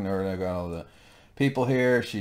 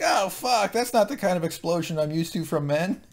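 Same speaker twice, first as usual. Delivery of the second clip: squeaky high-pitched woman's voice; high mock woman; 8 semitones higher than usual. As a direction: play her as shrill surprise, pitched way up. Mock female character quote.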